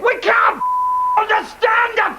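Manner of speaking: high angry retort; angry shout. Acted. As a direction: shout back in frustration. Player shouting back.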